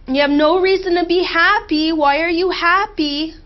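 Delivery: mimicking her inner critic. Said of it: "I hear your voice saying".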